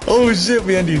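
sudden urgent warning; quick loud heads-up; alarmed warning. Real, not acted. Warning teammate of enemy.

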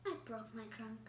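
low, soft and glum elephant; Gerald's voice. Acted. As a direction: say it low and quiet, a bit down. Gerald answers sadly.

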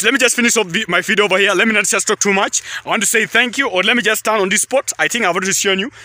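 usual speaking voice

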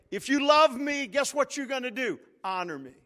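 loud, high challenge from God; voicing God. Preacher voicing God to the congregation, loud. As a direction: ask it as God teasing the answer. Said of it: "God's saying".

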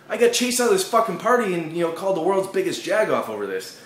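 mock-wounded comedic complaint; hurt rant. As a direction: vent how unfair it was. Ranting about being ridiculed.

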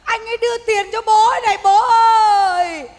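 loud mock funeral lament; hammed-up wailing. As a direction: wail it like a hired mourner, stretching the last words. Fake mourner wails to dead father.

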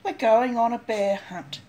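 usual speaking voice

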